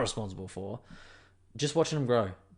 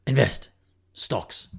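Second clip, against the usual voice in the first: mock serious money-man voice, clipped single words; investment voice; 2 semitones lower, slower than usual. Podcaster jokingly to listeners, hammed-up investor voice. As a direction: play a pompous investor with one-word pronouncements. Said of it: "That's my investment voice".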